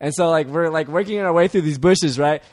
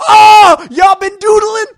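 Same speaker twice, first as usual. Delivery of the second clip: very high, loud hollered accusation; shouting girls; 10 dB louder, much slower than usual. Retold or imitated. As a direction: imitate a shrieking, scandalized onlooker. Voicing the girls' first words.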